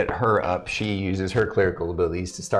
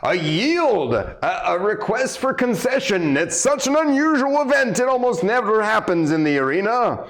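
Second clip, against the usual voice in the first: arena announcer; 13 semitones higher than usual. Acted. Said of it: "the announcer's like".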